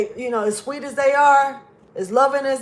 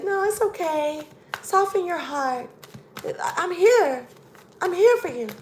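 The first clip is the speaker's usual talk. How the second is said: sugary soothing voice; 4 semitones higher than usual. Retold or imitated. Imitating the clingy partner soothing them, sugary and high. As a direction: coo it like a syrupy lover, lightly mocked. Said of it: "Why they talking like that?".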